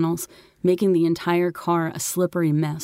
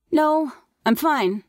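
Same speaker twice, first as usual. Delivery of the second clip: high, slow motherly reply; mom answering; 10 semitones higher, slower than usual. A narrator's reading. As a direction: call back a drawn-out refusal.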